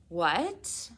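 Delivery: shocked friends blurting out together; exclaimed. Acted. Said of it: "Penn and Allie exclaimed".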